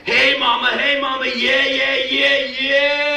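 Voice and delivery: high, loud, half-sung yeahs; sing-song holler from the far girder; crazy guy catcall. Retold or imitated. Wise-ass worker on the far girder, high sing-song catcall. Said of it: "that one wise ass".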